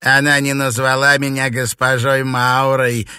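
loud, high, spiteful remark; needling old witch; snide. A narrator's reading. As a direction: point out the fault with malicious relish. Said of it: "ехидно заметила паучиха".

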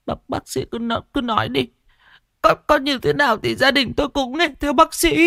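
put-on high, trembling old woman's voice; frightened old mother. Mother begging the doctor, high and frightened.

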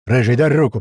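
loud, urgent call for help; shouting. Acted. Captain asks Ryzhy for a hand, shouting. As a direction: shout up to a mate. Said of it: "прокричал Фалит".

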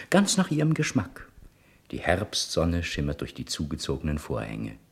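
ordinary talk, nothing marked

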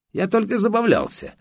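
high-pitched mock-innocent excuse; whiny excuse. Photographer defending himself to the journalist, high whiny excuse.

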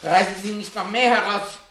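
high, loud, anguished pleading; desperate begging. Acted. Professor begs the fireman to stop, anguished and high. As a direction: beg as if about to be sick.

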